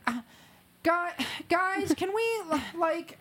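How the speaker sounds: put-on high, whining child calling out; whiny kid voice. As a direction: whine like a bored kid. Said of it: "in the background, you just hear".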